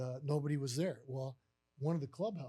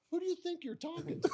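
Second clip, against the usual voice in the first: very high, not raised in volume; incredulous question; 13 semitones higher than usual. Retold or imitated. Manager realizing boss has the wrong man, incredulous. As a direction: ask in high-pitched disbelief.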